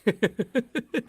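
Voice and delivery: high chuckling laugh; a run of high chuckles. Real, not acted. Co-host reacts to the joke about parents' basements, laughing.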